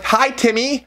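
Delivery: very high, sing-song sweet greeting; high-pitched, put-on sweetness; super sweet. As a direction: re-enact a sweet fan's hello. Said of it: "And I'm super sweet".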